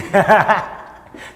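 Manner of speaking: loud, high burst of laughter. Real, not acted. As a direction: laugh out loud at your own joke. Laughs at own joke.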